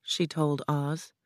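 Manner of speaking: usual speaking voice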